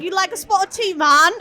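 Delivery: badly faked Jamaican, drifting into British; attempted Jamaican accent. Shown as a demonstration. Comedian proving she can't do accents, bad Jamaican accent. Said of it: "everything I say comes out Jamaican".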